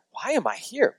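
sarcastic swoop up on the question. Shown as a demonstration. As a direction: ask it with mock exasperation. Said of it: "kind of sarcastically, like".

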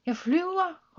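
gleeful high exclamation; happy shout. A narrator's reading. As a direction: cry out in joy mid-flight. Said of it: "råbte hun glad".

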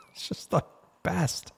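breathy near-whisper of delight; a soft, unvoiced aside of pure delight. Real, not acted. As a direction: whisper it to yourself, tickled. Delighted aside after the story.

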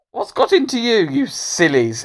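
high start, falling away; lightly voiced baffled schoolboy. Hamish asks the laughing class, mildly baffled. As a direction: ask it high, mildly put out.

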